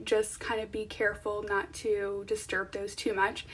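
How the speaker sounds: ordinary talk, nothing marked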